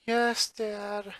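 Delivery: soft, resigned little reply; defeated. A narrator's reading. Boy answering his stern dad, soft and defeated. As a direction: give in, beaten and quiet. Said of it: "replied in a defeated tone".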